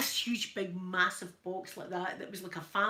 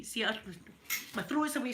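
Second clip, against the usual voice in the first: ordinary delivery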